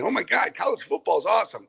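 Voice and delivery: high-pitched imitation of a giddy player; mock excitement. Coach imitating returning players jumping up and down, mock excitement. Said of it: "jumping up and down saying".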